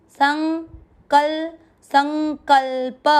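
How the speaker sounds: संकल्प with the half न through the nose; nasal half n. Shown as a demonstration. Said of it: "आधा न को नाक से पढ़ना है". (संकल्प में आधा न नाक से)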